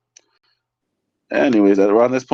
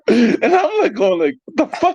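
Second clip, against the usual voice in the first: very high, squeaky laughing speech; cracking up mid-sentence; helpless laughter. Real, not acted. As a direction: squeeze the words out through laughter. Laughing at own punchline.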